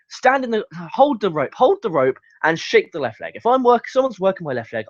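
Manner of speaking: ordinary delivery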